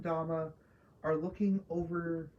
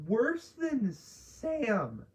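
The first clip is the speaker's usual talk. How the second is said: Worse than Sam drawn out slowly, voice cracking high; 5 semitones higher, much slower than usual. Real, not acted. Ranting about a TV character, voice cracks mid-rant. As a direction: let the voice break as you stretch it out. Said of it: "Voice crack".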